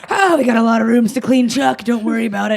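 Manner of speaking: high, loud, overbright deflection; forced cheery brush-off. Retold or imitated. Cleaner brushes off buddy Chuck, loudly casual. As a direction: wave the concern away with too much pep.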